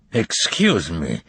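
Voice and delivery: a touch of suspicion; suspicious. A narrator's reading. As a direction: weigh each word, suspicious. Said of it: "he began suspiciously".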